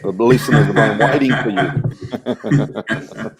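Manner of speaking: slow, laughing mock reproach; mock scolding a late mate while laughing; laughing tease. Real, not acted. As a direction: scold him slowly through a laugh. Teasing late friend.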